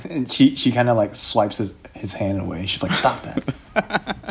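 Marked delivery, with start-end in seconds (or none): 2.9-4.3 higher, softer, brushing it off; irritated princess, high and dismissive; curt Shauna. Acted. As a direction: swat the fuss away with a short higher line. Shauna brushes off Tobin's hand.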